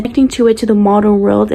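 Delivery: ordinary talk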